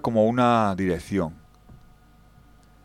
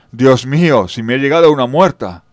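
loud, higher shocked outcry; horrified; 3 semitones higher, 10 dB louder than usual. Acted. Storyteller realizing the girl was a ghost, loud and shocked. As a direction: blurt out the horror of it. Realizes he met a dead woman.

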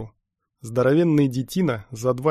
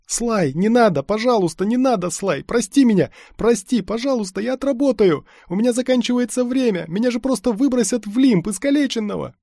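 high, loud begging; panicked pleading; 11 semitones higher, 6 dB louder than usual. A narrator's reading. Lad begs the stormtrooper for mercy, pleading in panic. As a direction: plead desperately, voice pitched way up.

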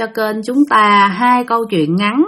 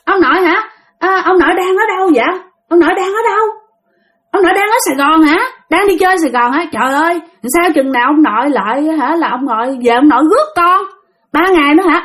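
put-on lightly raised, eager boy's voice on the phone; a boy chatting happily with his dead grandfather, pitched up; child on phone; 7 semitones higher, faster than usual. Boy asks his dead grandfather on the phone when he'll come, lightly higher child voice.

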